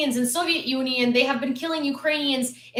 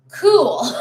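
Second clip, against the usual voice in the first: scornful laugh; 5 semitones higher, 6 dB louder than usual. Real, not acted. Scornful laugh after quote.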